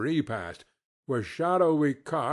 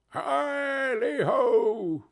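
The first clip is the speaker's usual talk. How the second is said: jovial Fezziwig; 11 semitones higher, much slower than usual. Acted. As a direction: boom it out like a merry old merchant. Fezziwig cries out.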